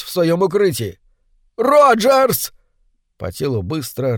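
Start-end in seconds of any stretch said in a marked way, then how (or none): 1.6-2.5 loud desperate call for help; shout. Acted. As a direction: yell the name in panic. Ричард зовёт на помощь. (громкий отчаянный крик)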